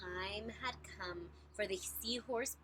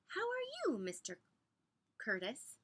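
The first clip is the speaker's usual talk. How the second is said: high, sing-song hello; cheery greeting; 7 semitones higher than usual. A narrator's reading. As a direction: call out a bright hello. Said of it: "asked Mister Seahorse".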